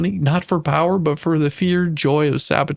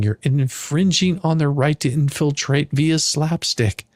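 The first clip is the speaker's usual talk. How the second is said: ordinary delivery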